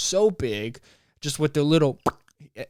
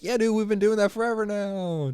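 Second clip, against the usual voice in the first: a smug dude who's done it forever, pitched up; voicing Florida; 7 semitones higher than usual. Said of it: "Florida's like".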